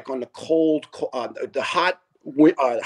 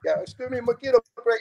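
high, meek retelling; imitating the caller again; 8 semitones higher, faster than usual. Laughing while replaying the doorstep caller, high meek voice. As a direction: mimic the timid caller. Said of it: "repeating the caller's line".

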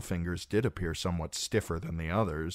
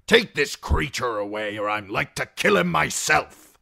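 loud, raised, growling disgust; angry growl; 8 semitones higher, 9 dB louder than usual. A narrator's reading. Lord orders the prisoner taken away, growling loudly. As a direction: growl the order with forceful contempt. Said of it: "Lord Nestor growled".

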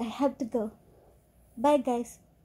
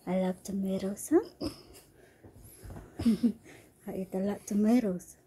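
usual speaking voice

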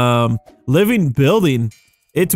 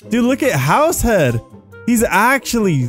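ordinary talk

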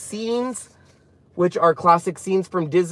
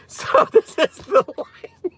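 giggling through the words, pitched high and slow; can't stop laughing while showing the next set; 13 semitones higher, slower than usual. Real, not acted. To viewers after admitting she cried, laughing high and slow.

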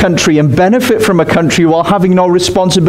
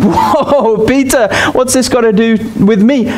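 higher, incredulous brush-off; hammed-up shrug of a crowd member; mock dismissive protest; 7 semitones higher, slower than usual. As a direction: wave Peter off in disbelief. Crowd's imagined dismissive reply.

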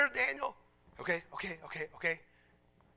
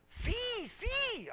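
high, squealed mock excitement; delighted retold agreement; 5 dB louder than usual. Preacher finally understood by the Russian woman, mock delighted. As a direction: burst out with gleeful confirmation.

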